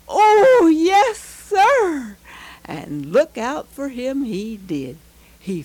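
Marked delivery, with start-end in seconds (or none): 0.1-2.2 put-on high, loud burst of a thrilled boy; overjoyed boy answering his father; excited boy. A narrator's reading. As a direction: squeal it out like a kid handed a puppy. Pete gets the puppy.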